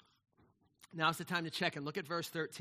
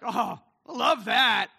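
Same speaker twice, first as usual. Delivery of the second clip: much louder, slow, drawn-out gush; gushing delight; 4 semitones higher, 12 dB louder, slower than usual. Retold or imitated. A guest gushes loudly and slowly over the crinkle Roxanne made. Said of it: "I was like".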